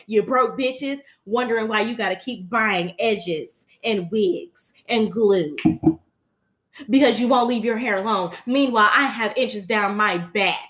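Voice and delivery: loud, sassy strutting brat; sassy kid imitation. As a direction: show off like a child model. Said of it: "Blue said".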